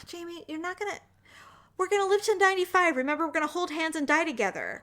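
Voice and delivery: imitating the husband sweetly promising they'll die together; husband reassuring. Wife retelling husband comforting her in the car, mock soothing. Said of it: "and he's like".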